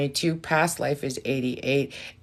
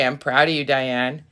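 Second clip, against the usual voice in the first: ordinary talk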